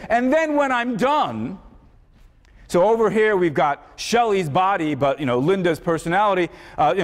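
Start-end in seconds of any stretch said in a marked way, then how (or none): 0.1-1.6 lightly voiced mad scientist, raised and slow; a hint of gloating villain; evil mad scientist. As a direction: draw it out like a villain announcing his plan. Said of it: "he's an evil mad scientist, he says".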